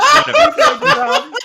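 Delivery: high, drawn-out yell; very loud, high comic correction; mock-outraged shout. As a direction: bellow the nickname slowly in comic exasperation. Mock outrage at forgotten nickname.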